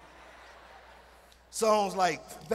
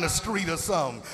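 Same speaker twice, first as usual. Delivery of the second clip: normal talking voice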